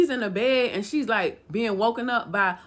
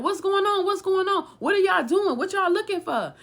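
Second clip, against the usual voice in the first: high-pitched, repeated questions in retold alarm; alarmed questioning; 9 semitones higher than usual. Woman to men searching her house, alarmed questioning. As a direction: act out her bewildered demands, pitched way up.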